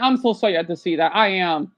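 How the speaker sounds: ordinary delivery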